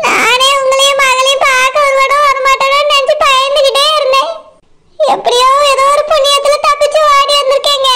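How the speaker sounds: tearful, breaking cry in a put-on voice. Wife recalls her fear to her family, crying. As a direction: cry out the fear of never coming home. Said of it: "அழாத".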